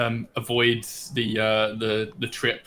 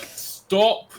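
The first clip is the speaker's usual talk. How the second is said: mild call to stop; 8 semitones higher than usual. Acted. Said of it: "just be like".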